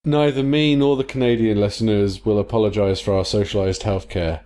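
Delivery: ordinary talk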